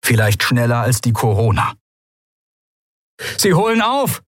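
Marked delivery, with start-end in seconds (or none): 3.4-4.2 high, urgent cry to the pilot; shouted warning. A narrator's reading. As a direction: shout the alarm across the cockpit. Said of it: "rief Kai".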